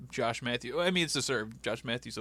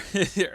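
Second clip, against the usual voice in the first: burst of laughter; 8 semitones higher, 7 dB louder than usual. Real, not acted. Laughs at Booker scene.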